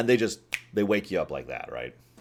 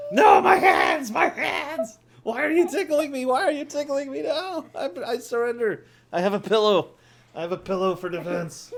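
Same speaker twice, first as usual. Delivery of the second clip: high squealing pleas; being tickled; 8 dB louder than usual. Pretend. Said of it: "I've been tickle tortured".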